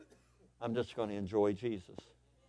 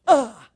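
loud, high startled gasp; 13 semitones higher, 15 dB louder than usual. Retold or imitated. Congregation frightened by a sudden yell, mock gasp. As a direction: jolt with a sharp gasp.

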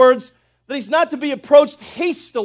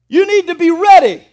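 higher, louder impassioned preaching; preaching at full force; 7 semitones higher, 9 dB louder than usual. Acted. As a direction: thunder it from the pulpit. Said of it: "preaching climax".

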